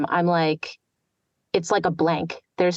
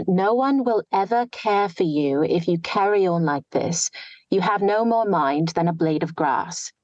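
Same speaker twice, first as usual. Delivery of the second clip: a light New Standard English accent on a read story; reading in target accent. Shown as a demonstration. Reads passage in target accent.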